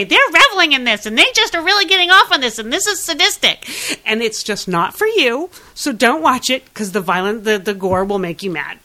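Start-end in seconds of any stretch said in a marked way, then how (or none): 0.0-3.4 higher, louder and fast, a hammed-up outraged complaint; a mock huffy rant, pitched up, loud and fast; mock indignant husband. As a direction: play the prickly husband tutting at the gore, hamming it up. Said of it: "you're gonna say".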